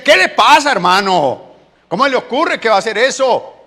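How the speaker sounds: loud, high-pitched acted rebuke; indignant scolding. God rebuking the prophet who asked, loud indignant scolding. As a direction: scold him in loud disbelief.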